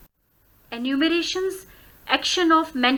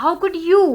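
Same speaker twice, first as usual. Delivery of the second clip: "you" pitched higher, rising at the end; stress on YOU; 4 semitones higher, faster than usual. Shown as a demonstration. Teacher showing raised pitch on last word, rising "you". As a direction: lift "you" in "How could you". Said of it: "pitch को ज़्यादा ऊंचा कर रहे हैं".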